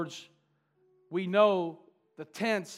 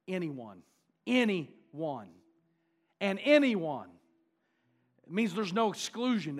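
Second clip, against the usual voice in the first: ordinary talk, nothing marked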